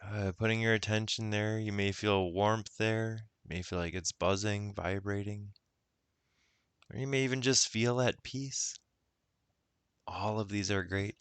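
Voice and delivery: unhurried guided meditation voice. Acted. Guide leading listeners into self-love, guided meditation. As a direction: ease the listener inward, with long pauses.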